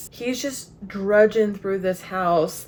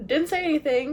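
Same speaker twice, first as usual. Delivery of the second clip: higher, feigned-innocent voice; imitating innocent Kreacher; 8 semitones higher, faster than usual. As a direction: play dumb, pitched up. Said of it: "Kreacher's just like".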